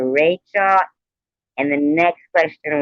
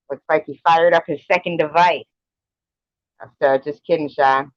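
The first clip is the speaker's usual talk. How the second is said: ordinary talk, nothing marked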